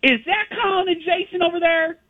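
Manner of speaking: high, bright mock Texan lady calling out; imitating tour guide Sally. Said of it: "and she goes".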